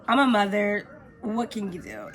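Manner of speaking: ordinary talk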